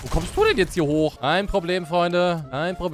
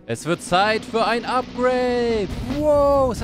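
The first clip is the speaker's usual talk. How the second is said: slower, higher and louder epic announcement; big drawn-out hero proclamation; booming proclamation; 8 semitones higher, 4 dB louder, much slower than usual. Pretend. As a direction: proclaim it like a god taking power. Announcing Thor upgrade, second take.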